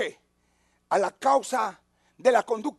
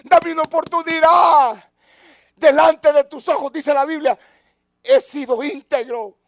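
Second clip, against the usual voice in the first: pleading cry; 3 semitones higher, 7 dB louder than usual. Acted. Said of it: "comienza a llorar y le dice".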